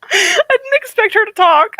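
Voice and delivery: loud, very high squeal, drawn out; startled, laughing. Real, not acted. Streamer jump-scared by audiobook's female voice, startled squeal. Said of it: "I jump scared myself".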